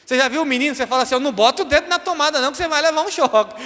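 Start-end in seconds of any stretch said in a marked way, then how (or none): 1.1-3.5 quick, higher-pitched scolding warning; warning parent. Acted. Parent warning a boy about the power socket, quick and higher. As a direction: rattle off a warning to a child.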